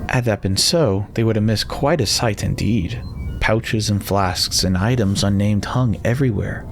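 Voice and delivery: ordinary delivery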